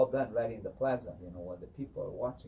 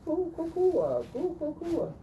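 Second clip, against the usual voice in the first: very high, loud hooting coos; kachina hooting; 7 dB louder than usual. Retold or imitated. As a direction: hoot like a whipping kachina. Kachinas pour water, hooting.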